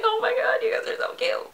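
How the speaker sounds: very high, squeaky adoring coo; fangirl gushing. Retold or imitated. Fan telling the band they are cute, high starstruck gushing.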